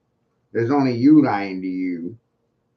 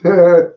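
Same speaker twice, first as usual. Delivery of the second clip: a sudden loud, high burst of devilish laughter; 7 semitones higher, 12 dB louder than usual. Real, not acted. Before calling truth-telling devilish, a loud devilish laugh. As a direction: cackle like you just thought of something wicked.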